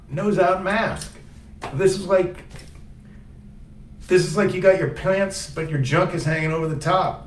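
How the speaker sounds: high-pitched outraged rant about the mask; indignant rant. Real, not acted. Ranting about nose-out mask guy.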